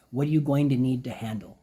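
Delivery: ordinary delivery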